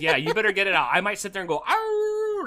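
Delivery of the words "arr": high drawn-out howl like a dog. Acted. Co-host imagining himself in a storm, howling. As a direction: throw your head back and howl. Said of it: "howl like the dogs".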